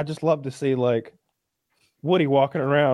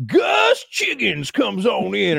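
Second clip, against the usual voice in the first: high, drawn-out prospector holler; old prospector; 8 semitones higher, much slower than usual. Acted. Comedian introducing his Will Ferrell bit, hammed-up old prospector. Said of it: "installing an old prospector".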